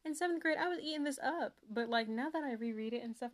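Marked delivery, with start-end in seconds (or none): none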